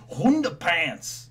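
drawn-out singer's swoop on the word. Retold or imitated. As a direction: stretch it out with a big rise and fall.

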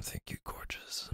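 soft whispered thanks; whispering. Acted. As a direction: breathe it out softly. Sleepy drunk whispered thanks.

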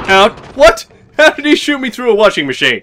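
loud, high disbelieving yell; loud incredulous protest; outraged shout. Real, not acted. Shot through cover, outraged.

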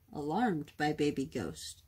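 plain reading voice

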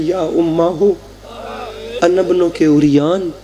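low, drawn-out wailing plea to his mother; child's crying lament. A narrator's reading. A child cries to his mother from behind the veil, in a low wailing lament. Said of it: "बच्चा रो रो के कहता है".

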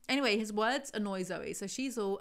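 ordinary talk, nothing marked

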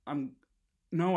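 normal talking voice

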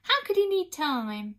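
high, loud outburst of dismay; queen crying out. A narrator's reading. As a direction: wail it as a distressed mother. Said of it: "cried the Queen".

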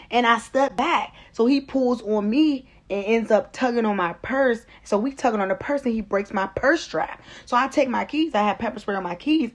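ordinary delivery

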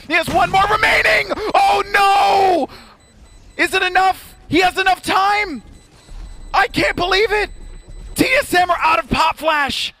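high, loud hype scream; excited shout. Real, not acted. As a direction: scream it as the round ends. Caster screams at clutch win.

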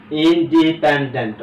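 "Independent" loud, slow, in distinct syllables. Shown as a demonstration. Teacher summing up long words for students, loudly syllable by syllable. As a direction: punch out each syllable of "independent". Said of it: "খেয়াল করো".

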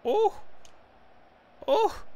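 loud, very high-pitched "oh!"; short sharp yelps of excitement; excited cry. Real, not acted. Gamer reacting to his own play, excited high cries. Near goal while gaming.

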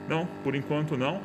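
usual speaking voice

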